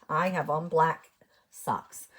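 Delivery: usual speaking voice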